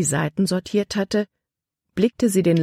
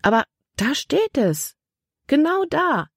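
higher-pitched, emphatic insistence; indignant, rising sharply in pitch; heated insistence; 11 semitones higher than usual. A narrator's reading. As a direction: drive the point home, pitch climbing high. Libby insists in a heated quarrel.

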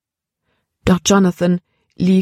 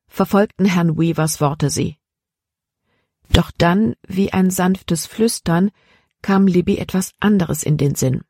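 usual speaking voice

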